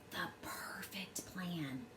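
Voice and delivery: hushed, slow whisper; conspiratorial whisper. A narrator's reading. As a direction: whisper it like a secret plan. Secret squirrel plan.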